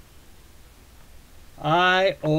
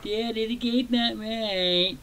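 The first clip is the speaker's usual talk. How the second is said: put-on high, slow, whiny little kid voice; whiny toddler; 6 semitones higher, slower than usual. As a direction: whine it like a sulking two-year-old. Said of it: "I sound like some whiny little two-year-old".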